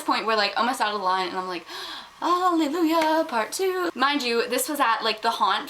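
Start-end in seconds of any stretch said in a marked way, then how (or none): 2.2-3.9 high, stretched hallelujah; mock rejoicing. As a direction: sing out the relief, hammed up. Second exaggerated cry of relief.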